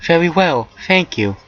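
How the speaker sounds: high, polite acceptance; high-voiced genteel assent; character reply. A narrator's reading. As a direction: pitch it up, courteous.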